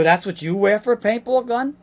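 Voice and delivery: loud, high, disbelieving questions; mock incredulity. Gangster-dressed actor to army-clad rivals, loud incredulity. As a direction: question their outfits in hammed-up disbelief. Acted incredulous argument at audition.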